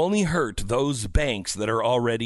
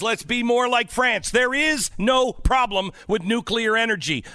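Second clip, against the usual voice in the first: raised, higher-pitched rant; yelling; 8 semitones higher, 4 dB louder than usual. Real, not acted. Radio host ranting to America about nuclear energy, yelling. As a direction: argue it loudly, pitch pushed up. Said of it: "I don't mean to yell at you".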